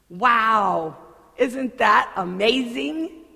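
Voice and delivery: amazed friend. Acted. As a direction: gush like a friend who saw a wonder. Friend marvels at amazing thing.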